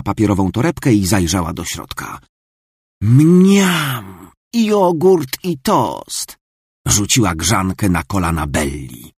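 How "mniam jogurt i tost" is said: slow relishing yum. Acted. Marta delights in her breakfast, slow and relishing. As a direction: savour the breakfast aloud.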